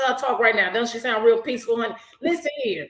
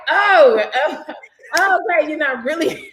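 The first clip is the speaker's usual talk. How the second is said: deflated surprise; 6 semitones higher, 7 dB louder than usual. Retold or imitated. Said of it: "I was like".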